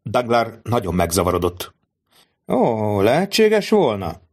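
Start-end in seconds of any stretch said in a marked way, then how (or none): 2.5-4.2 slow, higher mock surprise; slow, higher, put-on wonder; feigned astonishment. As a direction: pretend to be amazed. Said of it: "színlelt csodálkozással".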